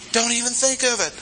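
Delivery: very high, emphatic refusal; revulsion. Acted. Preacher voicing Paul's answer on unfairness with God, appalled revulsion. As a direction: reject the notion outright, high in pitch. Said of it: "an answer of revulsion".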